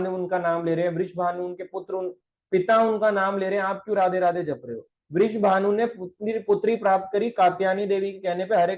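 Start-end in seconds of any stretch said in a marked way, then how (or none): none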